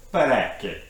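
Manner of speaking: Parecchi said with a rolled, sing-song Italian accent, stress on the middle. Shown as a demonstration. Explaining a species name to viewers in a playful Italian accent. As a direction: put on your best Italian accent for Parecchi. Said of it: "that was my best Italian accent".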